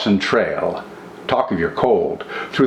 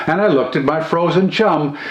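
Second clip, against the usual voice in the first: ordinary delivery, nothing marked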